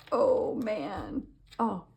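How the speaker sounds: ordinary delivery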